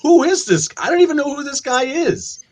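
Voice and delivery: high, incredulous mock confusion; baffled outrage. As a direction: sound utterly lost at the new character. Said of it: "And you're like".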